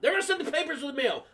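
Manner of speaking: high, fast, hurried relay; mom relaying news. Retold or imitated. Mom between son and principal on phone, hurried relay. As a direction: rattle it off up high. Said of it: "talking to me and the principal".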